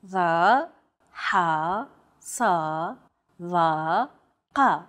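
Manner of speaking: Va, ha, sa, va, ka, each deep in a constricted throat; tense, throaty consonants in Va, ha, sa, va, ka, said separately; emphatic consonants. Shown as a demonstration. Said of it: "pronounced deep within the throat".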